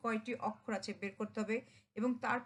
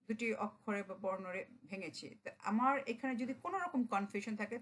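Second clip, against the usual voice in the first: normal talking voice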